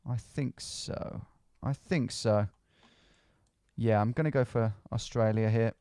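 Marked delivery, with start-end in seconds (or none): 0.1-1.2 slow breathy whisper to self. Real, not acted. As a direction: whisper it slowly under your breath. Whispered, then said aloud.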